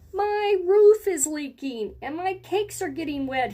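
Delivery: high, whiny baker; baker moaning. Acted. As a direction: moan it like the sky is falling. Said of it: "he moaned".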